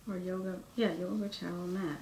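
soft near-whisper reading to herself; hushed, half-whispered reading; quiet murmur. Real, not acted. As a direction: mumble the label under your breath. Reading label softly to herself.

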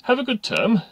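loud, high hearty send-off; booming hearty announcer. A narrator's reading. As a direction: sign off heartily to the whole school. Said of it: "said the loudspeaker heartily".

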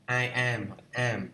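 ordinary delivery

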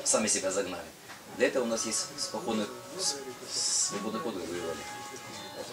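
ordinary talk

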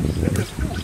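breathy whisper of delight; whispered aside. Real, not acted. To viewers by the clean stream, whispering. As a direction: hush it like a secret.

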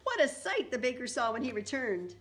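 usual speaking voice

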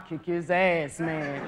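a hint of a street threat; mock tough threat; tough brother voice. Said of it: "All the brothers talking about".

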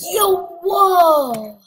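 high, loud gasping whoa; shocked shout. Real, not acted. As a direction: blurt it out, stunned. Big shock during fight.

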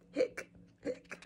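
breathy little hiccups; tiny voiceless hiccups; whispered hiccups. Acted. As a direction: hiccup under your breath.

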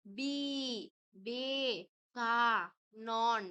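বি, বে, কা, নন each stretched out separately; syllable by syllable. Shown as a demonstration. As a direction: say বি, বে, কা, নন slowly with pauses between. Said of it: "ননটাকে আমরা টেনে উচ্চ". (বি, বে, কা, নন আলাদা করে টেনে বলা)

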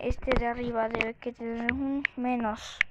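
ordinary talk

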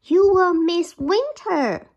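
high, loud, pleading little mouse; the mouse begs the bear not to sleep; mouse crying out. Acted. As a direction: call out urgently as the mouse. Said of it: "Mouse cried".

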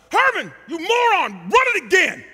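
high furious coach yell; hollering coach. Retold or imitated. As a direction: bellow down in disgust. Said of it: "Coach Ford hollers from the heavens above".

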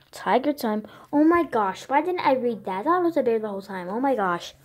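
loud shocked realization; oh my gosh, it was a tiger; surprised. Real, not acted.

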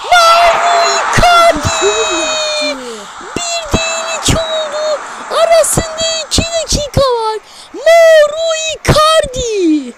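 high shouted goal call; screaming football commentator. Acted. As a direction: scream the scorer's name. (bağıran futbol spikeri)

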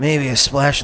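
ordinary talk, nothing marked

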